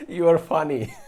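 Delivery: real laugh through "You are funny"; loud, laughing "You are funny"; cracking up at a viewer's joke. Real, not acted.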